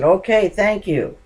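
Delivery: high, loud, cheerful thanks; stranger's reply. Retold or imitated. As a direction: call back a bright thank you. Stranger answers the shouted directions.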